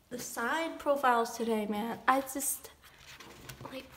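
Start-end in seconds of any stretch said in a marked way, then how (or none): none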